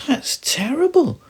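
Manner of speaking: high, loud alarmed cry; startled, scared of losing the planet; a high, loud gasp of alarm; alarmed little star. Acted. Little star upset at explosion idea.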